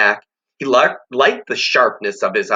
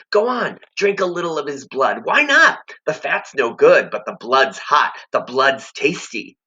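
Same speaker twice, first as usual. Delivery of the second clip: higher, coaxing wicked voice; a higher sly goblin coaxing; tempting creature voice; 4 semitones higher than usual. Acted. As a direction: lure him into biting. Jive tempting Harvey to bite.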